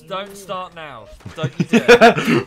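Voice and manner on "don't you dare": slow loud scolding; drawn-out shouted warning. Real, not acted. Scolding friends on stream.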